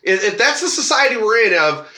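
ordinary talk

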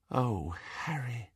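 soft, slow and moved; tender. Acted. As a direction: say it gently, touched. Hermione moved by letter.

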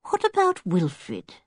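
mildly anxious, drawn-out question; slow, lightly worried inquiry. A narrator's reading. Missus Lehman asks Julian about Wilfred, mildly anxious. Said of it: "said Missus Lehman anxiously".

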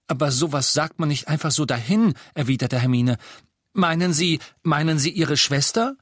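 höher und lauter, nachbohrend; Hermine, drängend. Acted. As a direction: nicht locker lassen. Hermine pressing Aberforth. (Hermine bohrt nach)

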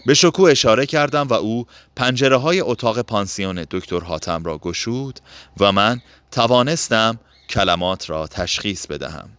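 ordinary delivery, nothing marked

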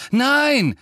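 high, loud cry of alarm; shout. A narrator's reading. Harry jumping between Sirius and Snape, shouting. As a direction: yell it to stop them. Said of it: "rief Harry".

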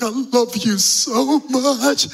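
high, slow, tearful voice; mock weepy farewell; blubbering dad. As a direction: sob it out like a wrecked new father. Father sobbing to baby.

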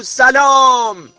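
long, high, loud call of سلام; shouting to a mountain. Pretend. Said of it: "به کوه با صدای بلند بگو". (فریاد بلند سلام به سمت کوه)

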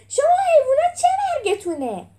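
very high, loud furious yell; high-pitched outburst of rage; exploding with anger. A narrator's reading. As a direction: roar at them, enraged. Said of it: "از عصبانیت منفجر شد".

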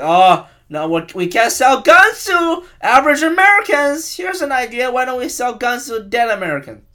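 mock gun executive. Gun company plotting to sell guns to soldiers, hammed-up villain. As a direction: sell the evil plan like a cheerful boardroom villain. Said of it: "Just like".

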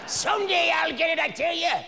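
high-pitched, put-on vow of a striver; hammed-up dreamer. Acted vow about a dream.

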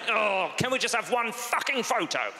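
high, exasperated British father; hammed-up fed-up father; mad British dad. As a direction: lose patience like a British dad. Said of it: "when he's mad at me".

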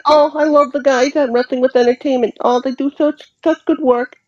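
high, fast lisping Mike Tyson voice; Mike Tyson impression. Co-host faking a Mike Tyson cameo, high and fast. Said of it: "our Cameo video ... from Mike Tyson".

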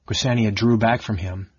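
ordinary delivery

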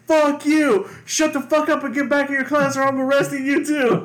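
high, loud acted-out telling-off; angry mock yell. Officer ordering mouthy student back to class, angry mock yell.

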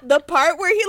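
ordinary delivery

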